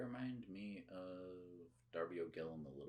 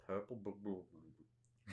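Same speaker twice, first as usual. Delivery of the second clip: put-on stumbling Scottish accent on bur-; imitating a Scottish tongue tripping on burglar; mock Scottish stammer; slower than usual. Mocking Scots who can't say purple burglar alarm, Scottish stammer. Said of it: "Scottish people trying to say".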